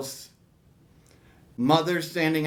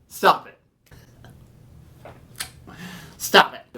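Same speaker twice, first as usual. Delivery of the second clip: retold protest. Child begging father to stop.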